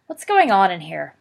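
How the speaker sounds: loud, stern demand from the doorway; father scolds noisy sons loudly; glowering father. A narrator's reading. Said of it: "their father glowered".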